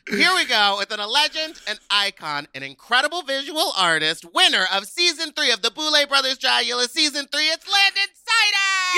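loud, high, building to a shout; hype announcer intro. Real, not acted. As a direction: bring the guest out like a host. Hyped guest introduction.

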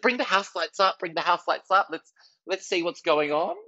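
put-on confident teen comic calling out; eager onstage call. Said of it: "I said".

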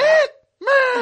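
loud high cry of a title; excited shout. Acted. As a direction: call it out with glee. Exclaims movie title.

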